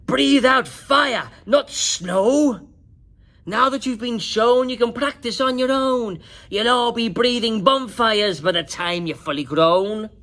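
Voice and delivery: loud, high teacher dragon urging the class; bossy dragon teacher. A narrator's reading. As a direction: project like a drill teacher. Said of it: "said Madam Dragon".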